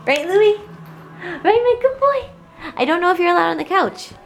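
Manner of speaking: high doting voice. Real, not acted. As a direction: coo at the puppy. Cooing at the puppy.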